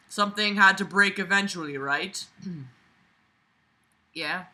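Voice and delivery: ordinary talk, nothing marked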